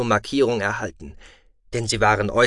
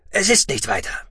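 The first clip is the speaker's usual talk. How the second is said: quick, higher reassurance; hasty; 8 semitones higher than usual. Acted. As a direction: rush to play it down. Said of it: "wiederholte Xantam hastig".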